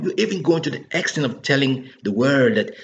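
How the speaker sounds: usual speaking voice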